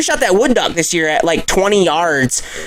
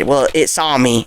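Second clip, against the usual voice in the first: ordinary talk, nothing marked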